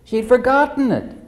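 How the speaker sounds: normal talking voice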